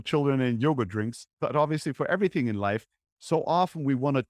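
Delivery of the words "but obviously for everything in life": ordinary talk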